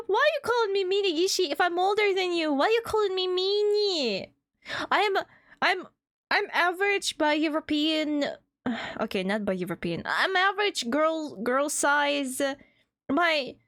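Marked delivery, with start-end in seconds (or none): 0.1-8.2 high, laughing mock outrage; playful indignant protest. Real, not acted.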